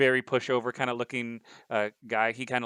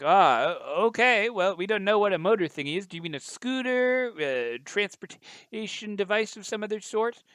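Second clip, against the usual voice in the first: high, loud newsman delivery; reporter voice; 9 semitones higher, 4 dB louder than usual. Acted. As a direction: talk like a polite TV reporter. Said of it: "and he's like".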